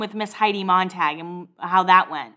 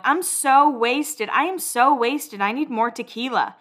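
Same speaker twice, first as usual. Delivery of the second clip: put-on slow, drawn-out drunken whine, higher pitch; wasted Heidi; 3 semitones higher, slower than usual. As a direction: imitate a drunk friend announcing she is wasted. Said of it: "you hear Heidi going".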